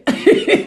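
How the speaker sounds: short burst of disbelieving laughter; real laugh. Real, not acted. Vlogger laughing at the madness of it. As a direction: laugh in spite of yourself. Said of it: "I'm laughing".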